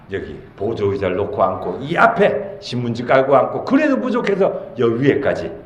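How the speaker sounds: ordinary delivery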